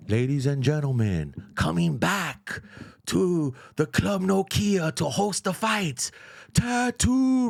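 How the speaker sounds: slow, drawn-out announcer call; ring announcer. Retold or imitated. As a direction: announce him back to the ring. Imitating ring announcer intro.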